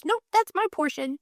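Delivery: high, put-on little girl declaring her share; prim kid voice. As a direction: chirp it like a firm, tidy child.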